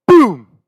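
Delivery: loud, high "boom"; imitated gunshot. Hunter mimics his rifle shot, explosive. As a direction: punch it out like a rifle going off.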